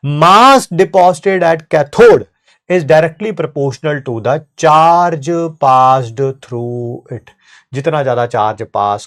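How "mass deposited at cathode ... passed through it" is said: very slow, word by word; dictating slowly, louder at the start; slow dictation. Shown as a demonstration. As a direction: spell the law out slowly for notes. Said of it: "चलो sir, बताओ क्या चीज है?".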